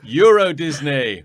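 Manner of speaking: slow, loud, high pompous French; mock French accent. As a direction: declaim it like a grand opening, slowly. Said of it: "he goes like".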